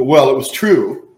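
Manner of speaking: loud, slow, stubborn "it was true"; mock the self-justifying speaker; defensive excuse. Preacher voicing a defensive gossip, loud and stubborn.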